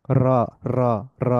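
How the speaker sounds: র rolled with an exaggerated trill; demonstrating an overdone rolled র. Shown as a demonstration. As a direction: roll the র hard to show the tap. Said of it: "আমি একটু exaggerate করে করতেছি".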